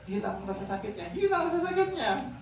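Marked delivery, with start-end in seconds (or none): 1.2-2.3 much higher, imitating the relieved patient; high mock patient voice. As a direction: squeak it out like the happy patient. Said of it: "Baru dia bilang".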